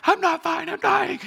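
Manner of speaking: high, loud hammed-up panic squeal; panicked. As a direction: shriek it like a dying man in a mock panic. Arguing with wife at 4am.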